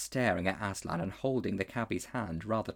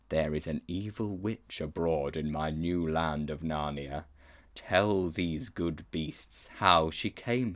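slow, low and grave lion; a solemn lion king speaking down; lion's voice; 3 semitones lower, slower than usual. Acted. Aslan questions the boy before his counsellors, slow and grave.

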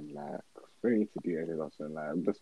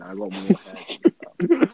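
loud; 11 semitones higher, 8 dB louder than usual. Real, not acted. Mock boasting to a footballer.

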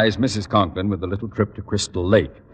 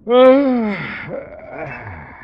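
contented sigh; 10 semitones higher, 4 dB louder than usual. Acted. Conklin settles into hammock.

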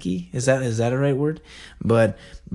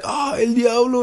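high-pitched imitation; a lighter mock outburst, pitched up; classmate's exclamation; 12 semitones higher than usual. Puzzling over what classmate means, high imitated exclamation. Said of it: "he's like".

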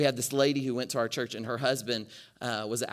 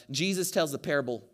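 ordinary talk

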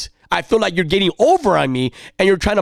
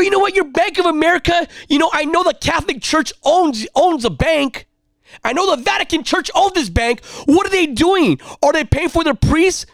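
retold angry rant; 11 semitones higher than usual. Customer going off at a Bank of America agent, angry high-pitched rant. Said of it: "I got so angry".

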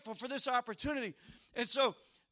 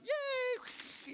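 put-on excited kids cheering; 11 semitones higher, 6 dB quieter than usual. Preacher imitating kids at the money machine, excited cheer. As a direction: squeal a thin little yay. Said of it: "man, they're all excited".

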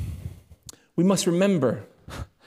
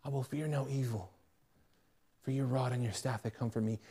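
soft scripture reading; 4 semitones lower, 10 dB quieter than usual. Acted. Quoting Psalm 23 softly.

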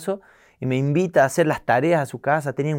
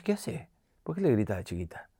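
soft, clucking reproach; grandma fussing over the child; indignant grandma; 3 semitones lower, 9 dB quieter than usual. Retold or imitated. Mother scolding about granddaughter.

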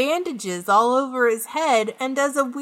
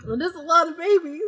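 laughing; 5 semitones higher, faster than usual. Real, not acted. Laughing at the joke.

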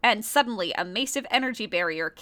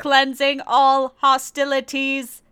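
metallic cyborg voice; 6 dB louder, slower than usual. Acted. Sounded Tobot.

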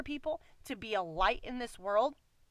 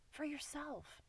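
For yourself repeated much softer, almost to herself; soft echo; 4 semitones higher, 11 dB quieter than usual. Shown as a demonstration. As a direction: let For yourself fall away quietly. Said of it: "but for yourself".

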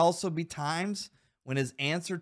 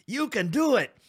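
put-on higher, louder quote in the Water Boy movie accent; full Water Boy movie accent, pitched up and loud; 7 semitones higher, 4 dB louder than usual. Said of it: "The, the accent".

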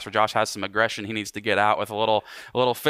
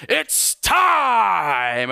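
shouted, much higher UFC announcer call; Bruce Buffer impression; 10 semitones higher, 5 dB louder than usual. Preacher mimicking the UFC announcer hyping the crowd, big shout. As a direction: belt it out to pump up the arena. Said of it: "comes out in the ring to get everybody pumped up and says".

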